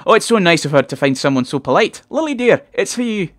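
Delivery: high, gushing Scottish mum; gush, then call out in a high singsong; overenthusiastic mother. Acted. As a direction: overeager mother calling her daughter to the phone. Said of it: "a little overenthusiastic given the mundane situation".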